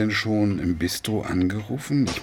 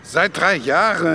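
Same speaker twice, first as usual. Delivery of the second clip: sharp, louder and higher, cutting her off; indignant retort; 7 semitones higher, 7 dB louder than usual. Acted. Father snaps at daughter over dead mother, indignant. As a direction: snap back at the daughter's accusation.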